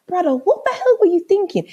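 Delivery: high, incredulous scolding; vexed. Retold or imitated. Woman to boyfriend on the way home, vexed. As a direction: demand an answer, voice pitched right up. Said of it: "I was so vexed".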